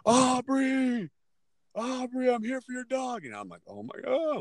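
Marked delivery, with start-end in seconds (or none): 0.1-3.3 high-pitched call from out in the road; imitated bully's yell. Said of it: "I hear this voice". 3.7-4.4 high-pitched mock wail; retold tearful dread. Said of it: "I'm in my room crying".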